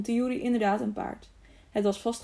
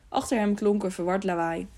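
ordinary delivery

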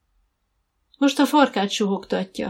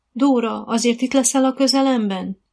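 higher-pitched, slightly anxious question; slightly worried; 6 semitones higher, 4 dB louder than usual. A narrator's reading. Rider asking the coach to stay close, slightly worried. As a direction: ask with a touch of worry. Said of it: "kérdezte Dorka kicsit aggodalmasan".

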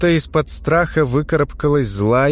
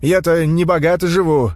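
raised, higher voice calling out; shouting at trespasser; 6 semitones higher than usual. A narrator's reading. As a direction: call out to the stranger, raised. Old man calls to stranger.

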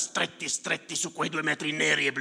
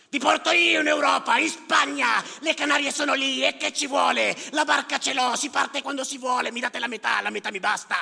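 put-on much higher, loud boastful young man's patter; Yusuf boasting loudly; 11 semitones higher, 7 dB louder than usual. Yusuf boasting to the fishermen, loud and cocky. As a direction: sell the trip like a cocky boy hawking it. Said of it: "Comincia a far girare la voce".

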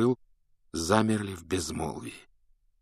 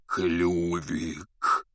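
hoarse croak; 3 semitones lower, 4 dB quieter than usual. A narrator's reading. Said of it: "прохрипел Хагрид".

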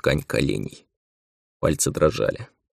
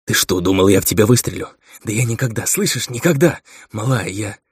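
vehement reassurance; 3 semitones higher than usual. Acted. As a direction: insist urgently that you never would. Shocked friend reassures her while embracing. (горячо, взволнованно уверять)

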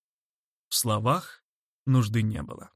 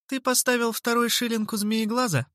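put-on high-pitched girl, matter-of-fact; businesslike inquiry; 10 semitones higher than usual. A narrator's reading. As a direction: inquire briskly and practically. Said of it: "деловито осведомилась Анджела". (деловито)

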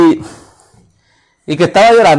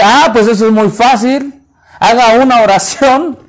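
voz del misionero; 6 semitones higher, 4 dB louder than usual. Retold or imitated.

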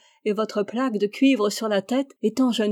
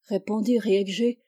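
plain reading voice

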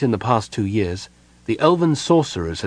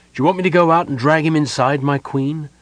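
a touch higher, brisk offer; quick, raised-voice offer to a queen; deferential bodyguard; 6 semitones higher, 4 dB louder than usual. A narrator's reading. Bodyguard offers help to his queen.

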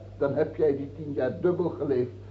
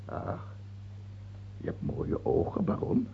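sly murmur; 12 semitones lower, 10 dB quieter than usual. Acted. King turns to sly flattery.

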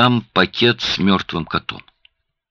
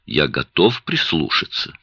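usual speaking voice

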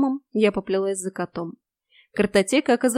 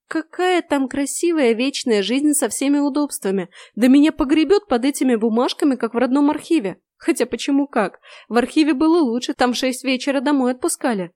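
despairing outburst; 5 semitones higher, 4 dB louder than usual. A narrator's reading. Heroine despairs.

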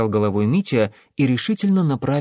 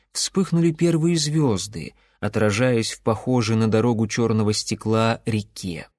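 plain reading voice

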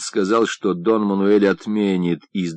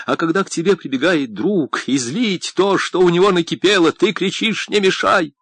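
higher-pitched, agitated accusation; impassioned reproach; 8 semitones higher than usual. A narrator's reading. Friend confronts the painter over his neglect, in heated reproach. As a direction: press the grievance with mounting agitation.

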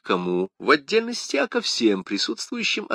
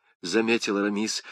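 ordinary delivery, nothing marked

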